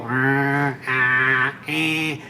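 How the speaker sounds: short "ah, ah, eh" off the character's tone; wrong tone. Shown as a demonstration. Impressionist showing what not to do, off-tone "ah, ah, eh". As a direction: say "ah, ah, eh" as plain test vowels, missing the cartoon tone. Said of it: "You can't go".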